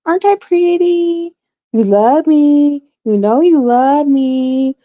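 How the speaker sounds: high, slow, sweet-talking pet voice; coaxing dog voice. Retold or imitated. Guilty dog to angry owner, imitated coaxing. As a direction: draw it out high, wheedling for forgiveness. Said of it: "And she was like".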